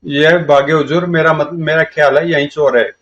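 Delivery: ordinary talk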